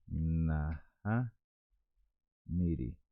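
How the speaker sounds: "naha niri" broken up softly with pauses; syllable-by-syllable pronunciation. Shown as a demonstration. Teacher introducing Guaraní no, careful split "naha niri". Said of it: "No en guaraní decimos".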